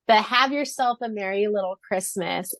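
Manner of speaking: ordinary talk, nothing marked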